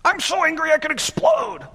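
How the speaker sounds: high, hammed-up shouted fury; squeaky mock tantrum; mock angry shout. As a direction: blow up like a cartoon hothead.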